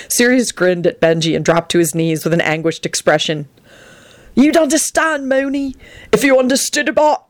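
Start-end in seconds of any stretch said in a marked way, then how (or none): 4.3-7.2 high, laughing shout. A narrator's reading. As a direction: call it out gleefully. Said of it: "he shouted out".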